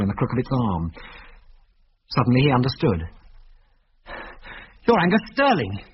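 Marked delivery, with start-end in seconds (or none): 4.9-5.8 higher, sudden realization; blurted. A narrator's reading. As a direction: blurt the name out. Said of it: "he blurted out".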